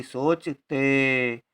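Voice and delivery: सोचते stretched very slowly, each syllable held. Shown as a demonstration. Teacher building the word सोचते for pupils, very slow. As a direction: say सोचते as if dictating it to a class. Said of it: "चा के साथ कोई उच्चारण नहीं".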